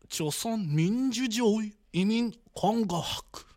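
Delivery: "Choson Minjujeon Inmin Konghak" slowly, syllable by syllable, in mock Korean; Korean name attempt. Shown as a demonstration. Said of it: "Ich hab's so richtig ausgesprochen". ("Choson Minjujeon Inmin Konghak" langsam und betont koreanisch ausgesprochen)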